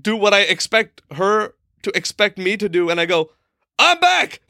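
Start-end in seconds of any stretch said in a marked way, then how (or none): 3.8-4.4 high-pitched, loud announcement; retold yell. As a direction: yell it out as you walk in. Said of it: "I just yell it".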